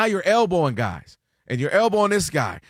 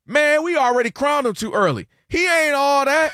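dismissive fan; 9 semitones higher, 4 dB louder than usual. Retold or imitated. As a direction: brush him off like a jaded fan. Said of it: "What's the first thing they say?".

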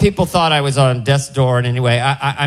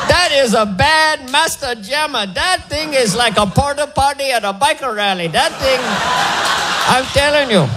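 put-on high, bright Indian doctor accent, much higher; 7 semitones higher than usual. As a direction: play the cheery Indian cardiologist. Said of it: "with that accent".

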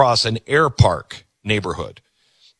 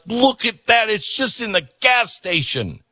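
mock excitement; 8 semitones higher than usual. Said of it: "She's like".

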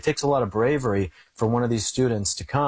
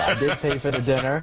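laughing; 4 semitones higher than usual. Real, not acted. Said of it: "laughing repeat".

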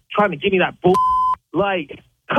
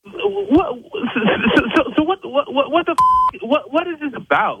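exasperated; 7 semitones higher than usual. Real, not acted. Angry prank call victim.

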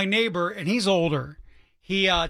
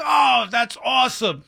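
drawn-out, higher, mock-impressed delivery; fake enthusiasm; 5 semitones higher, 3 dB louder, slower than usual. Radio host faking it for his neighbor, feigned enthusiasm. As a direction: put on slow, exaggerated delight. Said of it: "I had to fake it".

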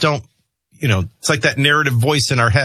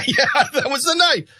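dad laughing. Retold or imitated. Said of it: "my dad's like".

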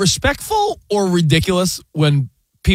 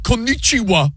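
higher-pitched mock Japanese accent; put-on Japanese accent; 5 semitones higher than usual. Traveler greeting people in Asia, mock Japanese accent. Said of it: "Are you throwing an accent on".

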